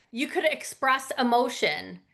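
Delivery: normal talking voice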